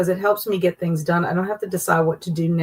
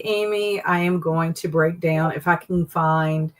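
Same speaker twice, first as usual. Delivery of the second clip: ordinary talk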